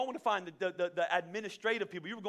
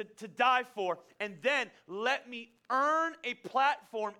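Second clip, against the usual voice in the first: slow, louder pleading prayer; fervent prayer; 4 semitones higher, 4 dB louder, much slower than usual. Acted. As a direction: pray it out slowly, pleading with God. Closing prayer in sermon.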